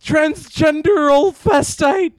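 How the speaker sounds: high, loud mock-accented wailing mom; melodramatic mother. Mock mom despairs at son's news, melodramatic high shouting.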